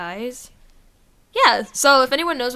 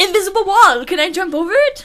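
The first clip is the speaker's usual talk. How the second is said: excited; 8 semitones higher, 8 dB louder than usual. Retold or imitated. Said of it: "You're like".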